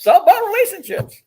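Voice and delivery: way up high, gleeful; high laughing exclamation. Real, not acted. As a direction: squeal it out as a joke. Joking echo of the lesson.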